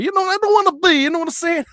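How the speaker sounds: playful Italian mobster impression. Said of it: "talking like an Italian mobster".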